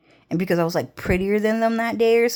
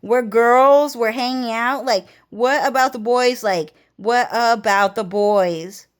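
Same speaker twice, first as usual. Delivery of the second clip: mock-outraged girl pleading; indignant protest; 3 semitones higher than usual. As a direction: defend yourself, exasperated. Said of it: "And then I was like".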